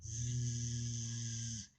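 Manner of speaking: a sleepy drawn-out snore; snoring. A narrator's reading. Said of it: "Amy snored".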